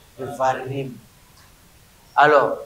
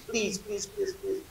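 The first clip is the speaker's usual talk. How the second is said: whispered please, please, much softer; pleading; 3 semitones higher, 15 dB quieter than usual. Real, not acted. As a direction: beg the class in a hush. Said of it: "je vous en supplie". (supplier à voix basse)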